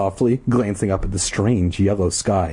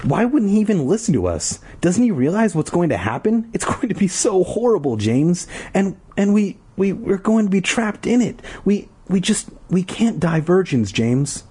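put-on panicked girl; 9 semitones higher than usual. Girl panicking to James about being trapped, high frantic. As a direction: spill it out high in frantic fear.

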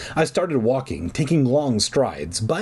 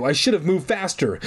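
ordinary delivery

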